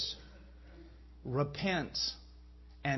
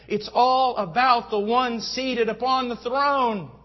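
loud, high, slow proclamation; impassioned preaching; 6 semitones higher, 8 dB louder, slower than usual. Real, not acted. Preacher telling a sir about heaven's throne, loud and fervent. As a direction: build to a ringing pulpit climax.

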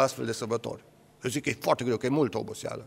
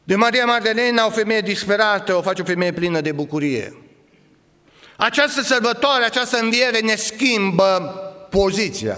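loud, higher proclamation; fiery preaching; 8 semitones higher, 5 dB louder than usual. Acted. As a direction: proclaim the change to the church. Preacher building with force.

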